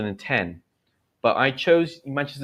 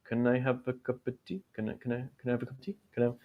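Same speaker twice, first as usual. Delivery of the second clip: copying attempt; 10 dB quieter, faster than usual. Shown as a demonstration. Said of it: "and then you try".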